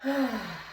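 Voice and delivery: long, releasing exhale; deep belly breath sigh. Shown as a demonstration. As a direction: sigh it out to demonstrate relaxing. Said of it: "take a couple of deep belly breaths".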